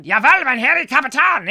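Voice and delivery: loud, high German military cry; mock German officer. Presenter saluting an imaginary captain, mock German accent. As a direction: shout like a comic German subordinate. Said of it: "He's not German".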